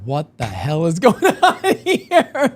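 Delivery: slow, drawn-out question dissolving into laughter; laughing disbelief. Retold or imitated. Retelling being asked if he's a vampire, laughing disbelief. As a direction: crack up while retelling your bafflement.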